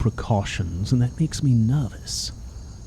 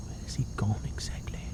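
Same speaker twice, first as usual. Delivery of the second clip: whispering; 3 semitones lower, 15 dB quieter than usual. Real, not acted. Muttering alone while playing.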